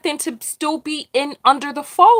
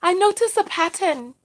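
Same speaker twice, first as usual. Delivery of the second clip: higher-pitched, badly imitated Australian lilt; bad Australian accent; 5 semitones higher than usual. Speaker retries the mother's closing line, bad Australian accent. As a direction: have another go at a shaky Australian accent. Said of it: "My, my bad Australian accent".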